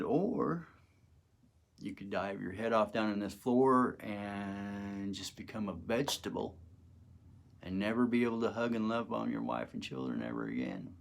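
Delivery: calm. Retold or imitated. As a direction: lay it out quietly and calmly. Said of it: "In the most calmest fashion".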